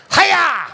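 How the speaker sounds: loud, high mock karate cry; karate yell. Magician playing ninja to fold a hat. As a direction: chop the air with a ninja shout.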